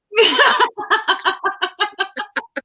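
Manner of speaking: very high rattling cackle; rapid high-pitched laughing; real laugh. Real, not acted. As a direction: burst into a long high laugh. Laughing after his own joke.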